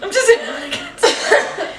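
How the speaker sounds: high laughing imitation; mocking echo. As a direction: copy her mockingly.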